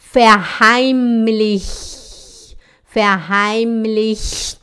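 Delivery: "Verheimlich" drawn out slowly; slow repetition. Shown as a demonstration. Teacher drills "Verheimlich" slowly with the class. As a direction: say "Verheimlich" slowly for them to copy. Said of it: "vou repetir isso várias vezes".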